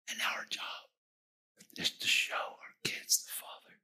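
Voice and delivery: soft whispering appeal; hushed exhortation. Real, not acted. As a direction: press the charge home in a whisper.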